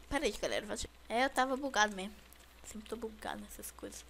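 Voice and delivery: ordinary talk, nothing marked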